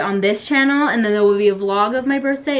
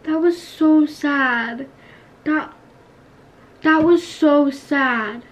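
mock sad; 4 semitones higher, much slower than usual. Just killed in the game.

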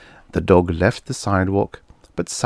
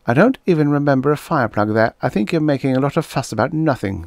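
put-on high, quick dismissive child voice; a kid brushing the fuss off; young child; 8 semitones higher, 3 dB louder, faster than usual. Boy dismissing the policeman, high and quick.

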